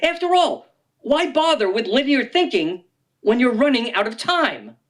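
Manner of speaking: ordinary delivery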